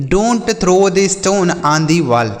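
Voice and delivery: each word said slowly and apart; slow clear example. Shown as a demonstration. Teacher reading an example sentence slowly to children. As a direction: dictate it slowly for young pupils. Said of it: "जैसे".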